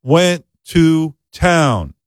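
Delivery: dragged out, very slow, word by word; mock slow reading. Struggling classmate finishing the line, very slow. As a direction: sound out each word painfully.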